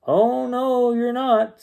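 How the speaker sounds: high, sing-out contradiction; emphatic denial. A narrator's reading. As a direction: protest it high on a long "Oh, no". Amelia contradicts the doctor.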